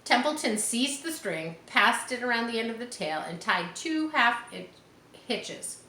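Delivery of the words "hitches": plain reading voice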